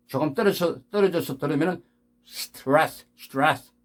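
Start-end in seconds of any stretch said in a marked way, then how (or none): 2.2-3.7 스트레스 as "stras" with voiceless st; barely audible st. Shown as a demonstration. Teacher showing st vanishes at distance, English pronunciation. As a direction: keep st in 스트레스 vowelless. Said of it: "에스티가 안 들리죠".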